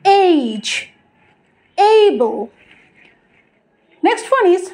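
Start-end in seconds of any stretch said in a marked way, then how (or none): 0.1-0.6 "এইজ" with a drawn ei glide; correct age. Shown as a demonstration. Teacher models the correction, gliding "এইজ". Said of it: "correct pronunciation শিখে ফেললাম". 1.8-2.4 "এইবল" with a long ei glide; correct able. Shown as a demonstration. Teacher models the correction, gliding "এইবল". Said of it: "correct pronunciation শিখে ফেললাম".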